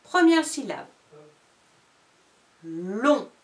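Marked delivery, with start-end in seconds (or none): 0.0-0.9 higher, louder announcing; dictation voice. Shown as a demonstration. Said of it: "Je fais une dictée de syllabes".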